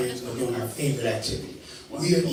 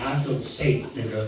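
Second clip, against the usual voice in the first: normal talking voice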